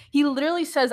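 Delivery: ordinary talk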